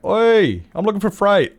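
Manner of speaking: retold call-out, pitched well up; imitated customer call-out. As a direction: play an impatient customer calling for service. Imitating newcomer calling out for service.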